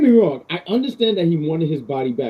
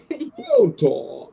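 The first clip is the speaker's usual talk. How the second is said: higher, muffled-villain impression of Bane; 9 semitones higher, 4 dB quieter than usual. A podcaster imitating the god who sounds like Bane. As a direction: do a quick mock villain line like Bane. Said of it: "he sounded like Bane".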